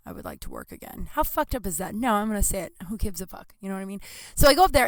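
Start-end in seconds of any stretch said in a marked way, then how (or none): 0.1-1.1 low, quiet deadpan joke; deadpan aside. Real, not acted. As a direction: drop the voice deadpan.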